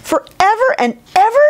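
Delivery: very high, ringing shout; excited shout. A narrator's reading. Little Bear joins the promise, excited high shout. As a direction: shout it out, pitch soaring. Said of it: "shouted Little Bear".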